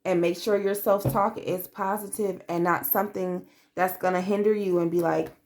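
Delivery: ordinary delivery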